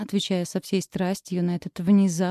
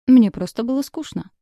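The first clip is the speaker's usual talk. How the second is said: higher and louder, bratty and bored; spoiled brat; 5 semitones higher, 7 dB louder than usual. A narrator's reading. Girl plays spoiled daughter to James, bratty defiance. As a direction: play the spoiled daughter to the hilt. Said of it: "игру в избалованную дочку".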